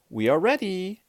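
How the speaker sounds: high, singing-out call; high-pitched call to the group. A narrator's reading. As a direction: announce it brightly from afar. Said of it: "called Amelia Bedelia".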